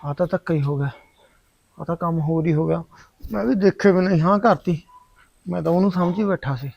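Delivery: low, soft muttering, resigned; sulking alone, barely audible; quiet grumbling to himself. Acted. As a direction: mutter it under your breath, defeated.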